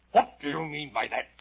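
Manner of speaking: loud, high, demanding question; stern. Acted. Storyteller as caterpillar questioning Alice, stern and loud. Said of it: "the caterpillar said sternly".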